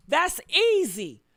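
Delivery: ordinary talk, nothing marked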